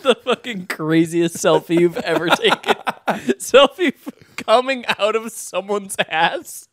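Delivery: talking through real laughter; helpless giggles breaking up the words; cracking up. Real, not acted. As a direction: barely get the joke out for laughing. Cracking up at the selfie joke.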